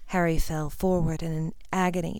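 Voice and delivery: usual speaking voice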